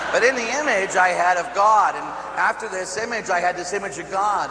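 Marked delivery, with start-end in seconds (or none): none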